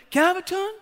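put-on high insistent kid voice; pleading little boy. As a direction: imitate a child pestering for a go. Dad voices son begging again.

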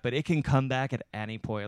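ordinary talk

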